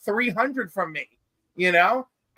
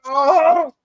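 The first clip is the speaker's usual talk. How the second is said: mock shock; 6 semitones higher, 7 dB louder than usual. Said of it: "I'm like".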